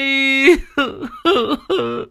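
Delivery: high put-on sobbing; fake sob. Cúc sobs falsely at the funeral, put-on sob. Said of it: "giọng điệu giả nhân giả nghĩa".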